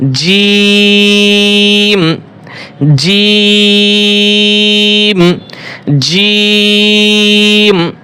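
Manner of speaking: জিম held very long, medium firm জ; four alif stretch. Shown as a demonstration. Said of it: "একটু শক্ত করে হবে". (মাঝারি শক্ত, চার আলিফ টেনে জিম)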